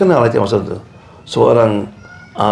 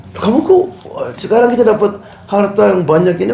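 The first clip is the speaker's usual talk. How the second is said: baffled scolding; 8 semitones higher than usual. Retold or imitated. Family scolding the crying son.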